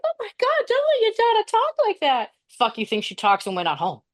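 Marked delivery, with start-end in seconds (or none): 0.0-2.3 much higher, mocking a hand-wringing parent; shrill-free squeal of mock outrage, way up high; mock hippie parent. A podcast host mocking a hippie parent's outrage, squeaky high. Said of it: "hippie parent who's like".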